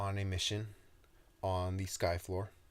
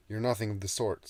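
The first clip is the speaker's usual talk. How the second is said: defiant retort; 4 semitones higher, 7 dB louder, faster than usual. Acted. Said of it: "said Toby".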